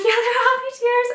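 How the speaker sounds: high-pitched mock tearful squeal; imitated bawling. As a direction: imitate blubbering through happy tears, very high. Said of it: "I was just bawling".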